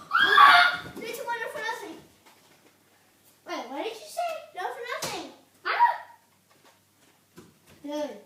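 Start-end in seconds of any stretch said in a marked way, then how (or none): 0.1-0.8 high startled yelp; sudden yelp. Real, not acted. Shot during countdown. 5.7-6.0 high startled yelp; sudden yelp. Real, not acted. Hit by dart.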